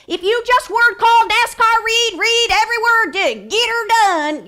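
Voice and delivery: high, loud, relentless delivery; noisy word-calling. Shown as a demonstration. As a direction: blare it out to drown the whisper. Mocks noisy word-calling against whisper.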